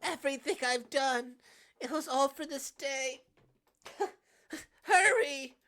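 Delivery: high, loud, strained cry; frantic, high-pitched begging; desperate urging. Acted. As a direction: urge at full voice as strength runs out. Character urges him to strike.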